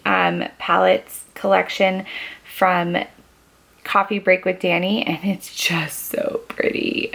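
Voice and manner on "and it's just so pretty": slow, hushed whisper of admiration; whispered "so pretty" at a swatch; awed whisper. Real, not acted. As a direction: breathe it out in awe.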